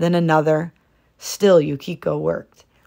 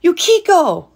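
much higher, louder cry; anguished cry; 13 semitones higher, 8 dB louder than usual. A narrator's reading. Husband crying to his crane wife, anguished shout. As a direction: cry out her name in grief. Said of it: "Osamu cried".

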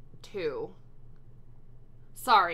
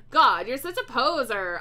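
raised, louder read-aloud jeer; shouting; 4 semitones higher, 6 dB louder than usual. A narrator's reading. Narrator character yelling at Hagrid, mildly shouted. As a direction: call it out at moderate shout. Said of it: "I shooted at Hagrid".

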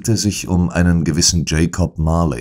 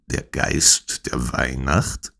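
slow, softer, puzzled question rising on "Weihnacht"; puzzled repetition; 3 semitones lower, 5 dB quieter, slower than usual. A narrator's reading. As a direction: repeat "Der Geist der Weihnacht?" slowly and quietly, baffled, lifting at the end. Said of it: "wiederholte ich".

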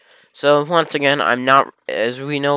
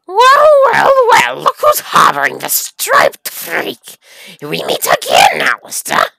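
high, loud, sneering villain voice; villain greeting; 7 semitones higher, 9 dB louder, slower than usual. Acted. Villain bursting in to taunt Alistair. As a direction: shout it like a gloating villain.

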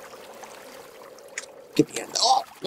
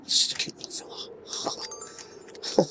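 cooing whisper; 9 dB quieter, much slower than usual. Real, not acted. Gamer cooing at cute little fella, slow whisper. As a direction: coo softly to a tiny creature.

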